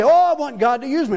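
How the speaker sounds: high, loud mock plea; high mock whine; whiny believer. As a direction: whine it like a spoiled kid. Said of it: "We say".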